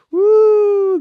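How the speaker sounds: shrill whoop of a rowdy fan; high whooping cheer. Pretend. Lancastrian betraying his side, with a high loud whoop. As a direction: let out a big football-terrace whoop. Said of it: "I'm shouting".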